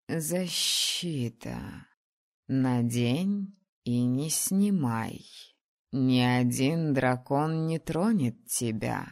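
very slow, low, serious dragoness; grave dragon instruction; solemn dragon voice. Acted. Dragon gives amulet.

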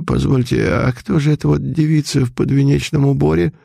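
startled priest asking about the bride, trembling; trembling old priest. A narrator's reading. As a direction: let the old man's voice shake. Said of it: "трясущийся, вздрагивающий голос батюшки".

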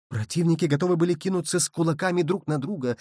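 usual speaking voice

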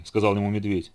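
ordinary delivery, nothing marked

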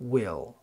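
"will" with a distinct ih vowel, as in "sit"; clear short i. Shown as a demonstration. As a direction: make the i in "will" clearly heard. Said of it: "بتبقى كسرتها ظاهرة". (will بكسرة ظاهرة)

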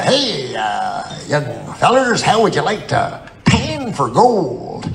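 put-on slow, drawn-out old prospector. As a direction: play an old gold panner, stretching the words. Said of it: "pretending to be an old prospector".

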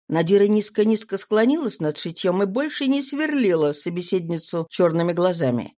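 plain reading voice

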